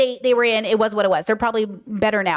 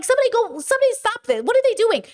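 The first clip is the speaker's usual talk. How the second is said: much higher, pleading for someone to step in; frantic plea; 11 semitones higher than usual. Retold or imitated. Family watching uncles brawl, high frantic plea. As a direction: squeak it out like you can't believe your eyes.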